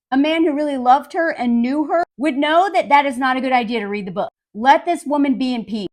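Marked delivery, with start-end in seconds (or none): none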